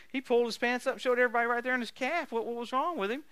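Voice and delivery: normal talking voice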